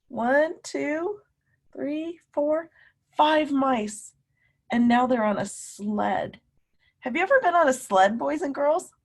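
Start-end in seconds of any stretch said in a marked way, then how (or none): none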